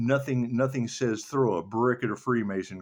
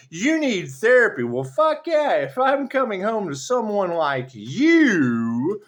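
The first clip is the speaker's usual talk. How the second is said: high, loud and slow scolding; loud mocking retort; 10 semitones higher, 13 dB louder, slower than usual. Loud slow retort to the partner who calls her crazy. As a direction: throw it back at him loudly.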